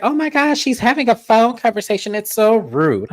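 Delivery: high-pitched imitation of an offended castmate; mock scandalized. Host voices castmate shocked by phone conversation, mock scandalized. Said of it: "Brittany's like".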